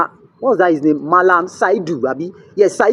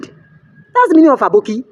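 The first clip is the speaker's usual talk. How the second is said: normal talking voice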